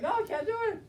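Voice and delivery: hammed-up dad giving up; mock whiny dad. Dad refusing to go back.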